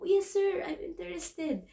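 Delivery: high, eager yes sir. Retold or imitated. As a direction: jump in keen to get the job. Eager reply to employer, retold.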